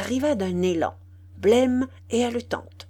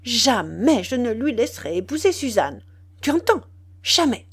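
fierce energy; 3 semitones higher, 5 dB louder than usual. Acted. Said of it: "avec une énergie farouche".

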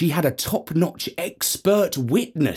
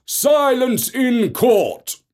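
slow commanding call; 11 semitones higher, 3 dB louder, much slower than usual. Acted. Judge hammering for order over the uproar, slow commanding call. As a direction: Silence in court drawn out slowly, higher. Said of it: "called the judge".

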